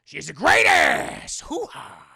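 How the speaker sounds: slow, loud bark of Pacino; hoo-ha it like Pacino; Al Pacino impression. Streamer doing Pacino for chat, loud slow shout. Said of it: "Al Pacino impression".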